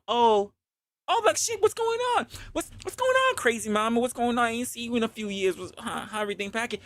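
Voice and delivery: high, surprised greeting voice; excited woman's voice. Retold or imitated. Ex bumping into him at the bookstore, high excited voice. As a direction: greet an old flame, high and bubbly.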